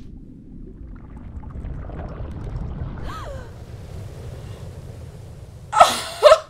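long high breathless gasp of wonder; huge gasp. Real, not acted. Player sees the sprite, huge gasp.

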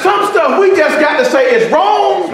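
rising shout from the pulpit; preaching at full force. Acted. Pastor preaching to the congregation, shouting.

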